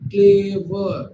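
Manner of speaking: ক্লেভার slowly as ক্লে, ভার; two drawn-out syllables. Shown as a demonstration. Teacher showing students two syllables, ক্লেভার slowly split. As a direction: stretch ক্লেভার over two beats. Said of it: "ক্লেভারে দেখো দুবার উচ্চারণ করছি".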